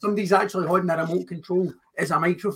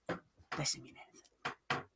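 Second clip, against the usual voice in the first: whispered; 20 dB quieter than usual. Acted. Whispered within promo.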